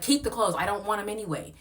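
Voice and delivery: high, loud dismissive retort; loud high dismissal of the host; heated retort. Retold or imitated. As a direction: brush her off loudly. Own retort in heated argument.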